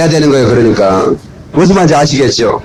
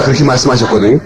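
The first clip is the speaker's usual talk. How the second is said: usual speaking voice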